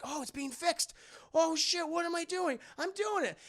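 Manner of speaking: high, astonished youngster's voice; acted wide-eyed wonder; amazed Peter. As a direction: marvel at your own unexpected success. Peter amazed the ship mends.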